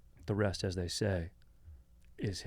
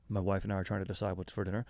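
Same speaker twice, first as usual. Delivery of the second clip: ordinary talk, nothing marked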